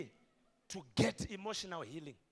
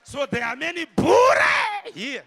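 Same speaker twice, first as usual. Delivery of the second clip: emphatic; 8 semitones higher, 15 dB louder than usual. Real, not acted. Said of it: "You have to scream".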